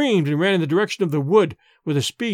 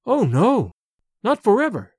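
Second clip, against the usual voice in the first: raised, pleading cry; 4 semitones higher than usual. A narrator's reading. Sedenka refusing a final farewell to her suitor, crying out. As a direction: cry out in alarm at your own words. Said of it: "Sedenka cried, then recoiled, as if afraid".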